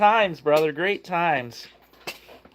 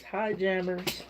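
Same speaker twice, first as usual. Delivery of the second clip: slow, much softer reading of "Hi, Jammers."; passing on a viewer's hello to the dog softly; relaying Jen's greeting; 4 semitones higher, 11 dB quieter, much slower than usual. Retold or imitated. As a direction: read Jen's message out gently and slowly. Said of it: "your buddy Jen says".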